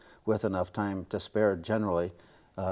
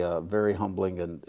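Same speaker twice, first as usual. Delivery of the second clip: ordinary delivery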